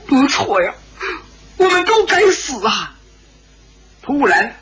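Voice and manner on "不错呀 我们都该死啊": high, tearful lament; crying. A narrator's reading. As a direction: wail the self-blame through tears. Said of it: "石破天也哭着". (哭着高声自责)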